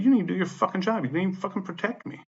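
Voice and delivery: high, worked-up accusing tone; retold angry accusation. Tenant telling the manager she failed him, indignant.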